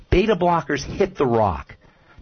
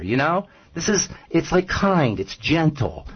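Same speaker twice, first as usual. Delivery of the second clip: ordinary talk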